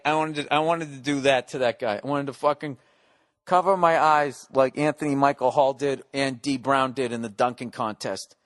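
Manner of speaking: usual speaking voice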